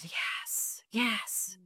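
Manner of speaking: whispered, imitating a moved woman; breathed assent. Young woman agreeing to boyfriend seeing scan, whispered. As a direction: retell it in a hushed whisper. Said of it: "took this big breath and she said".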